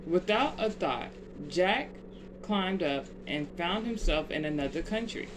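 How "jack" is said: plain reading voice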